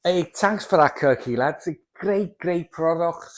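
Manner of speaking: high, loud put-on Scouse accent; hammed-up attempt at a Scouse accent. Plasterer thanking Kirk for the product in a mock Scouse accent. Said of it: "that's my excuse for, uh, a Scouse accent".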